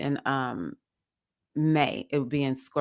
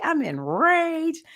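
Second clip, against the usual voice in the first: squeaky drawn-out enraged, much higher; hammed-up high slow fury; playful high-pitched slow rage; mock rage, high and slow; 12 semitones higher, much slower than usual. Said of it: "I'm like".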